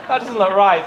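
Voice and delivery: mock uneasy thought; doubtful thought. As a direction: voice the nagging worry with a chuckle. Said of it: "I was thinking".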